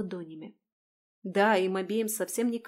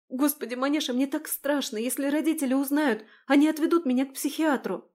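frightened; 6 semitones higher, 6 dB louder than usual. Acted. Страх признания.

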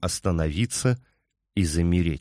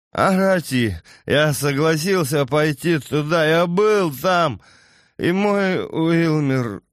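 put-on grieving drunk; 7 semitones higher than usual. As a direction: mourn the son like a wrecked drunk. Voices drunk grieving Yago.

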